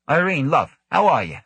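Devlin, breezy. A narrator's reading. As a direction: sing out a friendly hello across the room.

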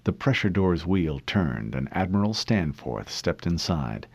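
ordinary delivery

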